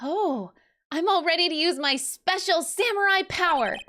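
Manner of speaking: high, lightly voiced game sidekick; eager companion. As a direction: pitch it up for the sidekick.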